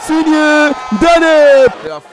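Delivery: loud, high goal shout; excited sports commentary. Real, not acted. Goal call.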